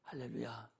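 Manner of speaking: a breathless whisper; whispered amen-like hallelujah. Real, not acted. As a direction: hush it right down. Whispered hallelujah after loud quote.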